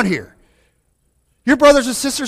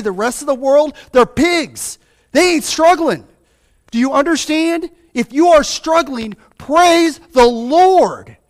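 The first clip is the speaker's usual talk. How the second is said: raised, ringing pulpit voice; fiery preaching; 6 semitones higher than usual. Acted. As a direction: preach it out hard to the church. Preaching at full force.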